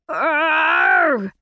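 high, loud anguished roar; anguished shout. Acted. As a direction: roar out in agony. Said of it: "Chapman cried".

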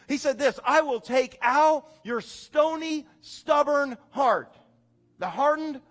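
loud, high and drawn out preaching; preaching at full force. Acted. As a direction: proclaim God's promise to the church.

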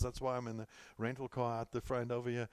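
usual speaking voice

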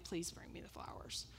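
whispered plea, barely voiced. Whispering to husband on the phone for the flowers. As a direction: breathe the request without voice. Asking husband, retold quietly.

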